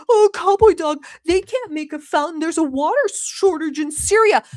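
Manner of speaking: loud, high mock-whiny hater voice; parodying the chat's haters who yell at her. Host mocks disgusting haters in her chat, loud mock-whiny voice. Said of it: "yell at me and be like".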